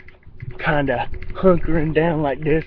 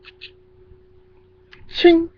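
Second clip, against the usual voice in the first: clicking "ch" sounds ending in a high ringing "ching"; imitated gun loading; 4 dB louder than usual. Telling of a gun heard loading, imitated clicks. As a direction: mimic a gun being cocked. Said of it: "and it's like".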